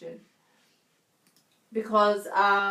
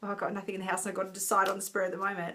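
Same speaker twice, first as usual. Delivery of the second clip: rushed mock panic; 5 dB quieter, much faster than usual. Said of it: "It's no good to go".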